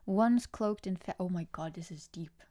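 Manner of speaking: usual speaking voice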